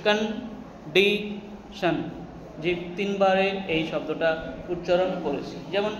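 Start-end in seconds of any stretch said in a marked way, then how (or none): 0.1-2.0 "condition" in three separate beats; slow, syllable by syllable. Shown as a demonstration. Teacher showing a three-syllable word slowly, syllable by syllable. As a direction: pause after কন and after ডি, drawing each out. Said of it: "উচ্চারণ যখন ধীরে ধীরে করবে".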